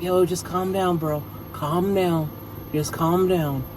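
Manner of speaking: a hint of a soft, low calming voice. Official calming down the angry champion, lightly soft. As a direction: ease him down gently, bro. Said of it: "Pierce is like".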